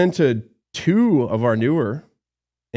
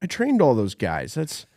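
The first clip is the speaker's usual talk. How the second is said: posing as manager; 5 semitones higher, 3 dB louder than usual. Retold or imitated. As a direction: sell himself slyly as the boss. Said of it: "act like I was the manager".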